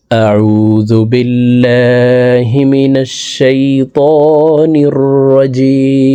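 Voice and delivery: slow, drawn-out chanted recitation; Quran recitation. Shown as a demonstration. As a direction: intone it slowly like tilawat. Teacher recites opening formula. (ধীর সুরেলা তিলাওয়াত)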